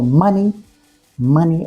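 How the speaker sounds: money with MON stressed; MON-ey, second syllable light; first-syllable stress. Shown as a demonstration. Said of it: "usually the first syllable is stressed".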